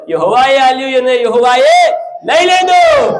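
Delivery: high shouted quote; ringing, high pulpit shout; preacher's proclamation. Retold or imitated. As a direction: cry the quote out to the congregation. Preacher proclaims Job's words.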